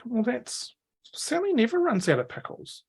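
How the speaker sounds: put-on high kid voice, baffled and hushed; indignant orc girl. Orc girl doubting Sally ran out of pickles, high and baffled. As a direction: refuse to believe it, half-whispered.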